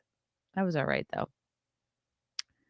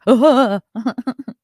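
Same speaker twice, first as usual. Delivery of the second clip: loud, high wordless outburst; mock waking-up noise; 7 semitones higher, 10 dB louder than usual. Joking that he wakes up stuck in [name], loud mock waking noise. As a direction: act out jolting awake with a loud noise. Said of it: "he just wakes up every day. He's like".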